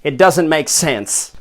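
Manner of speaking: host losing patience; exasperated rant. Acted. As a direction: rant at the silly chant. Host ranting at English guest.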